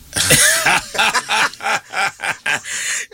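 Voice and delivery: a drawn-out, high belly laugh. Real, not acted. Laughing at the retold remark.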